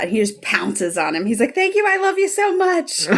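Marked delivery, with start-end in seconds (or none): 1.5-3.1 thrilled gushing. Retold or imitated. Charlie pounces on Nick, thrilled.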